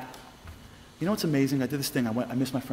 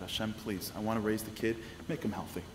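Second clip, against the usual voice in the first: much softer, pleading prayer to Hashem; hushed prayer; 4 semitones lower, 8 dB quieter than usual. Retold or imitated. Mother praying to Hashem for her baby, softly. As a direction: pray it quietly, almost to yourself.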